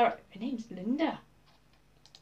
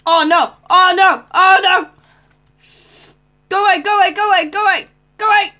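loud, higher frantic cries; panicked yelling; 6 semitones higher, 9 dB louder than usual. Real, not acted. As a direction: shoo the monster away in panic. Mob attacking, panicked yelling.

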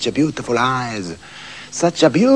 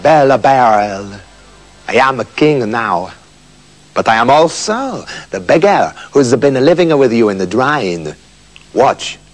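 low, gentle kingly voice; a touch of tender king; kindly; 6 semitones lower than usual. Acted. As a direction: speak kindly to calm her. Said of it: "said to her kindly".

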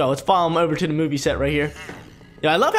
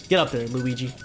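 normal talking voice

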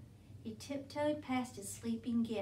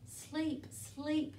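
soft, higher sleepy coo; 4 semitones higher, 3 dB quieter than usual. A narrator's reading. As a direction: coo it drowsily, like settling to sleep. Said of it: "he cooed".

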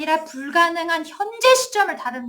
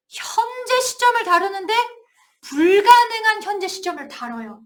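ordinary talk

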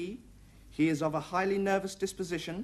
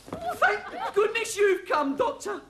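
hammed-up panic; 10 semitones higher than usual. Orderly hamming panic to doctor.

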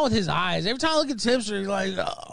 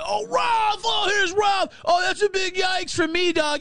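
mocking Twitter troll; 8 semitones higher than usual. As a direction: sneer it back like an online heckler. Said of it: "they're like".

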